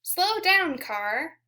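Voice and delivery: ordinary talk, nothing marked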